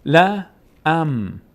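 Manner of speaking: "لا ام" in loud, separate syllables; short "لا", then hum the m of "ام". Shown as a demonstration.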